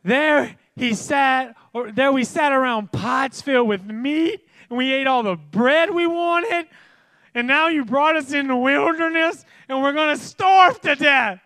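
high, loud whining wail; hammed-up starving moan; whiny Israelites. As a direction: complain like a grumbling crowd.

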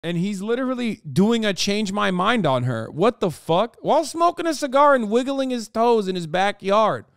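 high, loud disbelief; real incredulous outburst. Real, not acted. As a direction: rant in rising, appalled disbelief. Incredulous reaction to the husband.

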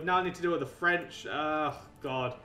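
ordinary talk

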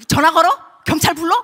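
loud indignant questions with a laugh; heated outrage. Retold or imitated. Pastor fuming at home about the couple, loud indignant questions. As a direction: fume out loud, half laughing. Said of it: "막 열나는 거".

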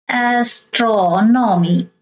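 Astronomy drawn out slowly, stress on TRON; slow teaching demo of Astronomy, leaning on as-TRO-nomy; third-last syllable stressed. Shown as a demonstration. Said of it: "you stress the third syllable from the".

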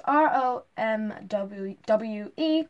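slow, letter by letter; spelled out. Shown as a demonstration. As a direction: spell R O M W E out one letter at a time. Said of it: "I don't know how you pronounce it".

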